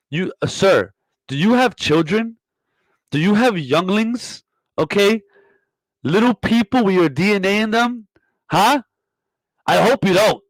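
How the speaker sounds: scandalized rising questions; indignant grilling. Acted. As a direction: grill them in disbelief, pitch riding high. Mock interrogation of a guest.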